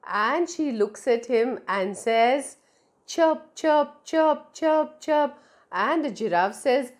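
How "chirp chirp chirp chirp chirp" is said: higher bird chirps; chirping bird. Acted. Little bird chirping up to the tall giraffe, higher calls.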